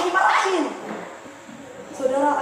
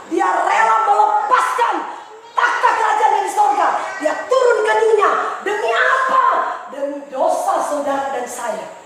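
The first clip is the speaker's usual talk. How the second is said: loud, high shouted sermon lines; full-force preaching; 6 semitones higher, 5 dB louder than usual. Acted. Preacher exalting the king.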